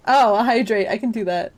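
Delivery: normal talking voice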